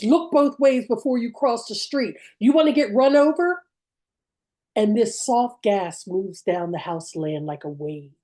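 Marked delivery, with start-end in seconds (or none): 0.0-3.6 raised, higher-pitched scolding yell; a storyteller's scream of an angry uncle, not full volume; imitated uncle's scream. Uncle scolding child at the curb, imitated scream. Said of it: "he screams".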